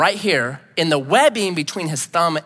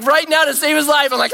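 ordinary delivery

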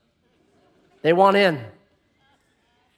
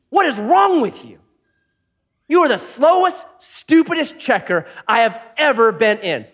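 higher, louder mock rant at the checker; imitating an angry yell; 6 semitones higher, 3 dB louder than usual. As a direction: replay the rude customer going off. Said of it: "the guy checking out is yelling".

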